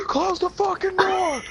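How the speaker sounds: high, slow furious mock scream; yelled command. Yelling at friend who interrupts the speech, high drawn-out shout. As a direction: scream the order, stretching it out.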